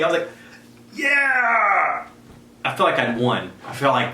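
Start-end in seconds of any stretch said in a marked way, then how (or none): 0.9-2.1 much higher excited cheer; thrilled yell. Retold or imitated. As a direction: whoop with pure kid joy. Boy thrilled by the gift.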